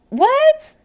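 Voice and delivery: sharp high loud what; stunned by the hypocrisy; disbelief. Real, not acted. Vlogger reacting to the hypocrite accusation, loud surprised what.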